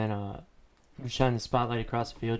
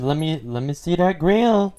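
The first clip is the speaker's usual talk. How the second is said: higher, louder playful demand; eager teasing; 6 semitones higher, 8 dB louder than usual. Real, not acted. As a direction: tease him to flash his teeth. Friend reacts to veneer news.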